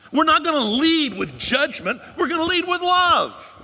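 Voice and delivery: loud, slow and high, every word driven home; emphatic proclamation. Real, not acted. Preacher declaring to the congregation, loud and slow.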